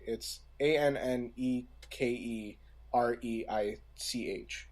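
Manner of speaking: ordinary delivery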